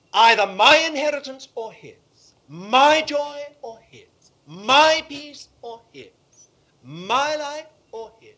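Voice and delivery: loud, slow and emphatic, each phrase hammered; ringing pulpit declaration, fading a little on the last phrase; preaching at full force. Real, not acted. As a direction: drive each contrast home from the pulpit. Preacher builds to the crisis.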